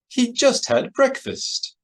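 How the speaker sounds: high, slow fussy droid voice; C three P O. A narrator's reading. Droid objecting to Skywalker being hungry, high fussy voice. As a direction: pitch it way up as a protocol droid. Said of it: "C three P O said from his place".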